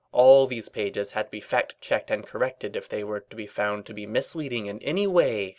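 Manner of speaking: plain reading voice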